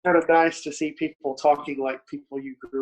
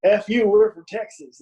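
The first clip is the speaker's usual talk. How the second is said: higher and louder, a cocky mock yell; mock tough Texan shout; 7 semitones higher, 8 dB louder than usual. Imitating a proud Texas metal band, mock loud bravado. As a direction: throw out a swaggering Texan boast.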